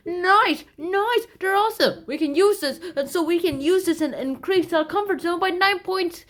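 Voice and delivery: high, loud excited chatter; excited people. Retold or imitated. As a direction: gush like thrilled fans.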